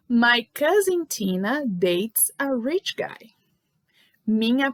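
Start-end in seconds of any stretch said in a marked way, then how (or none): 0.1-3.3 slow read. Shown as a demonstration. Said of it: "teacher reads example sentence".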